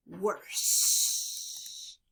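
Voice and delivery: usual speaking voice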